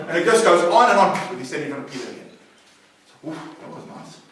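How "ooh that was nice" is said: whispered, awed aside; hushed whisper. Retold or imitated. Man whispering to Peter after touring hell, awed. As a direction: breathe it out as a hushed wonder.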